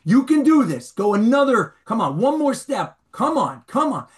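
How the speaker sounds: high, loud coaching yell; screaming coach. Retold or imitated. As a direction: yell encouragement right in his face. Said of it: "screaming at him".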